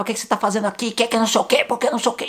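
high-pitched mock yelling at a stranger; hammed-up furious biker shouting at the narrator; retold yelling. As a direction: yell it back the way the angry biker did. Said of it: "ele gritava comigo e gritava".

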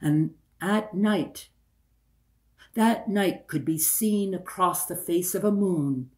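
usual speaking voice

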